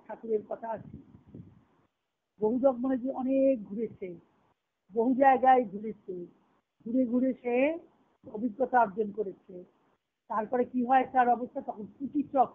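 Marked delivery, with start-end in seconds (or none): none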